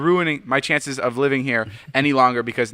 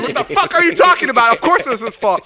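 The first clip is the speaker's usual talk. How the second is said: high-pitched mock outraged yell; retold screaming; 11 semitones higher, 5 dB louder than usual. Imitating Jason standing outside the car, mock screaming. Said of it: "like, fucking screaming".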